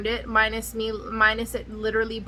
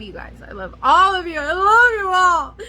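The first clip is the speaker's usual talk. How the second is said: fake sobbing; 5 semitones higher, 5 dB louder than usual. Gushing sign-off to viewers.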